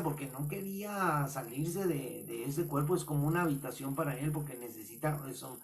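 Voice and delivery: ordinary talk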